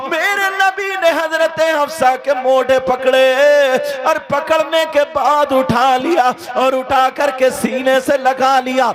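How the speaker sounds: high, loud, chanting sermon delivery; impassioned preacher singing out the story; full-force preaching. Acted. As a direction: preach with soaring, half-sung fervour. Sustained full-force preaching, half-sung.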